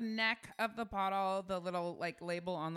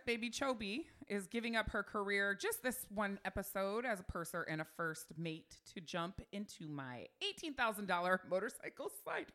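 ordinary talk, nothing marked

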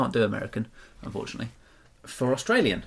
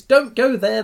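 higher, much louder warning; called-out warning; 11 semitones higher, 10 dB louder, slower than usual. Acted. Warning all the friendlies about the robot, called out. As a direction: shout it out to warn them.

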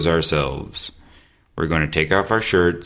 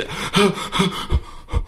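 shivery cold gasp; 11 semitones higher, 4 dB quieter than usual. Retold or imitated. Shock of cold water.

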